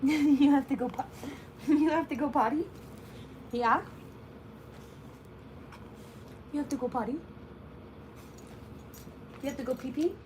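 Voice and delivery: soft, quiet pet-talk cooing; sweet-talking the new puppy, quiet and cooing. Real, not acted.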